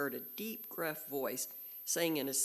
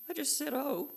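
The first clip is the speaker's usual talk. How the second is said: nervously; 13 semitones higher, 4 dB louder than usual. A narrator's reading. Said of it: "said Piglet nervously".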